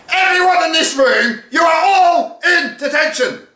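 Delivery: very high, loud furious shout; teacher losing it completely; snapping. Acted. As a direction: she finally snaps at everyone. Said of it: "finally snapped".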